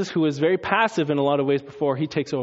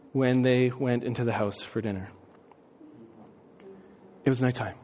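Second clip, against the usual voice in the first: ordinary talk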